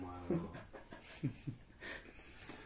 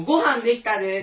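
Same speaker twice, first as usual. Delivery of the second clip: loud, high dinner call; mock call to come eat; 7 semitones higher, 10 dB louder than usual. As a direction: call from the kitchen to another room. Family calls that dinner is ready.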